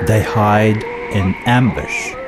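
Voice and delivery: ordinary delivery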